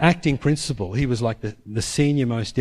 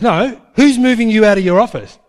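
higher and louder, pressing the question; insistent raised voice; 7 semitones higher, 8 dB louder than usual. Retold or imitated. Principal pressing the chaplain about the office, raised insistent voice. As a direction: demand an answer, rising heat.

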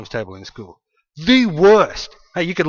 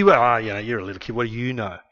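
dismissive scoff; 4 semitones lower, faster than usual. Retold or imitated. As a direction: shrug it off like a doubting adult. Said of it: "It wasn't just empty".

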